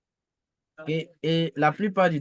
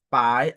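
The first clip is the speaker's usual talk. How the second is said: "pai" gliding upward; rising model "pai"; second tone; 3 semitones lower, 5 dB louder than usual. Shown as a demonstration. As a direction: dip into "pai", then lift it. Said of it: "le deuxième ton maintenant".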